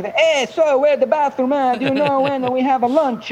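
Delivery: high, sing-song Italian accent. Shown as a demonstration. Tourist mimicking locals asking for the bathroom, high Italian accent. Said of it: "in, in the accent".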